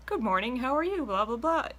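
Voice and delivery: high, sing-song fake pleasantries; mock chirpy greeting. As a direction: ham up the small talk greeting. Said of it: "I don't do the whole".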